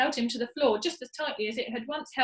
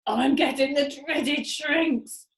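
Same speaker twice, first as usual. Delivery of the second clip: dreading Mister Twit; 3 semitones higher, 5 dB louder, much slower than usual. Acted.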